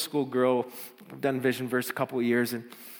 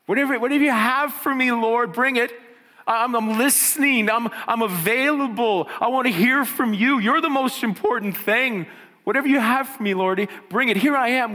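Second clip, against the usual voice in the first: higher, louder, pleading with full conviction; fervent prayer; 8 semitones higher, 7 dB louder than usual. Pretend. Pastor voicing a believer's prayer to the Lord, fervent and loud. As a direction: pray it out boldly, all in.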